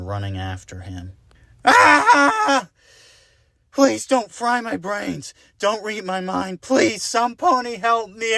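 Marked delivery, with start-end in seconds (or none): 1.6-8.4 high, loud panicked begging; shrieking terror; terrified pleading. Acted. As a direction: beg for your life while running. Fleeing unicorn, begging.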